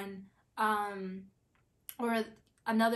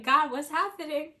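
panicked; 6 semitones higher, 6 dB louder than usual. Retold or imitated. Panicking at the bear noise.

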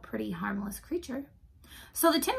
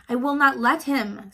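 louder, emphatic promise; firm assurance; 2 semitones higher, 8 dB louder than usual. A narrator's reading. Woodsman promises mice he will hold the dog, firm. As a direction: state it firmly to settle their fear.

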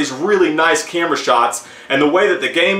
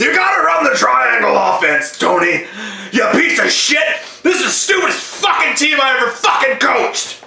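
higher, yelling angry coach; Nick Nolte impression; 7 semitones higher than usual. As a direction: roar at the team like a coach. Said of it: "That's Nick Nolte".

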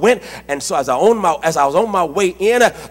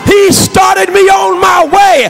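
full-force preaching; 10 semitones higher, 5 dB louder than usual. Real, not acted. Preacher rousing the congregation about the heavenly Father, full-force preaching. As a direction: cry it out like a preacher in full flight.

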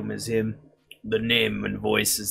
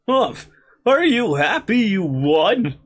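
sulky rival voice; 11 semitones higher, 7 dB louder than usual. Acted. Voicing rival after defeat.

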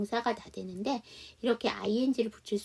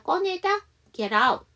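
ordinary talk, nothing marked

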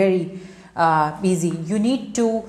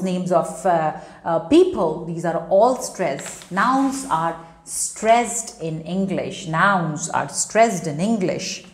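ordinary talk, nothing marked

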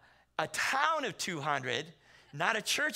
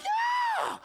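very high loud squeal; mock panic; 6 dB louder than usual. Shaken pastor answers about horns, panicked squeal. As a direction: shriek the answer in comic hysteria.

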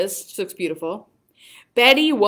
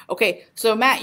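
ordinary delivery

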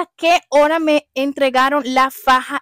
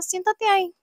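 1 curt nurse; 8 semitones higher than usual. Retold or imitated.

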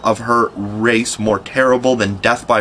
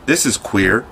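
ordinary delivery, nothing marked

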